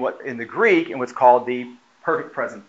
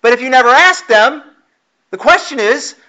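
higher and louder, driving the point home; full-force preaching; 7 semitones higher, 5 dB louder than usual. Acted. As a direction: preach it out to the congregation, building.

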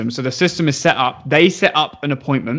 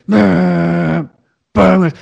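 imitated phone buzz; 3 semitones higher than usual. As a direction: hum the iPad buzzing on the table. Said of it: "all I hear is".

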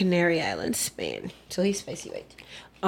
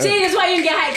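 laughing; 10 semitones higher, 4 dB louder than usual. Real, not acted. Teasing banter among friends.

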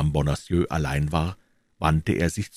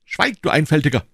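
high, loud rebuke; impatient raised-voice command; called out sharply; 9 semitones higher, 5 dB louder than usual. A narrator's reading. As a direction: silence the servant with a shout. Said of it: "rief D'Artagnan".

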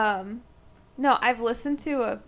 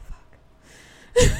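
loud exclamation; 13 dB louder than usual. Retold or imitated. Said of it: "I'm like".

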